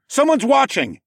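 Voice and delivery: high, loud alarmed call; urgent warning. Acted. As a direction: call out to alert the group. Warns the group during attack.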